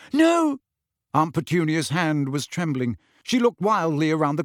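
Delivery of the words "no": high, sudden cry of fear; frightened refusal. Acted. Aunt trembling over the envelope, frightened refusal. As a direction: refuse in a panic.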